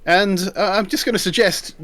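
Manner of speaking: normal talking voice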